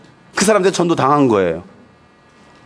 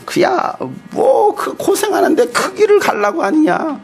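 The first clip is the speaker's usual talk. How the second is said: much higher, mock protesting voice; objecting family; 10 semitones higher, slower than usual. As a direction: protest as a disapproving relative. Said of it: "얼마나 반대하고". (높은 소리로 만류하는 가족 흉내)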